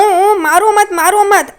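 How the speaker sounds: high, imitated whimpering plea; mock cries begging for mercy; pleading. Tejas begs while being beaten, imitated high plea.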